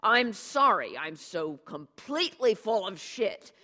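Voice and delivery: loud, bitter mock apology; sarcastic diatribe. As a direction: spit the self-insults out loud and bitter. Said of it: "the diatribe backfired".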